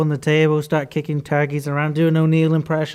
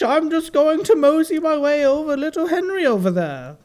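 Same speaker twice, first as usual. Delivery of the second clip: high-pitched silly turkey voice; sly turkey; 9 semitones higher than usual. Acted. Turkey moseying over to little Henry, high character voice. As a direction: keep up the high cartoon turkey.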